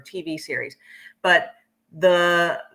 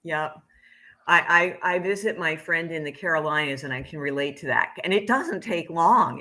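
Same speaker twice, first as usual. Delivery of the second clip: ordinary talk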